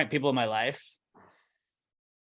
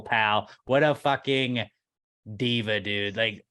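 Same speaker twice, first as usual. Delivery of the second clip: ordinary talk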